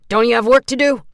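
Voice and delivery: sneering reprimand; scolding. A narrator's reading. Orderly scolding Lawrence.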